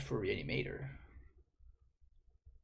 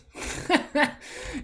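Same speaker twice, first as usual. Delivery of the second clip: cartoon laugh; 13 semitones higher, 3 dB louder than usual. Real, not acted. Laughs at the opponent's play.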